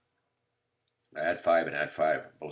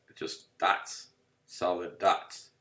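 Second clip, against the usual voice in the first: slow emphasis; much slower than usual. Shown as a demonstration. Stressing the graph result.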